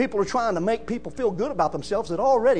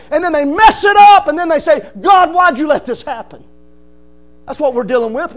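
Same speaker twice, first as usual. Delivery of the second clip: building to a loud high challenge; fiery preaching; 5 semitones higher, 7 dB louder than usual. Acted. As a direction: rebuke the proud listener. Preaching at full force.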